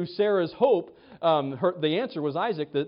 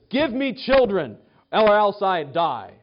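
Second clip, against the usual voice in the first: loud, slow, pleading demand; wife demanding children from her husband, loud and slow; desperate demand; 4 semitones higher, 6 dB louder, slower than usual. Retold or imitated. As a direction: plead it high and loud, dragging it out.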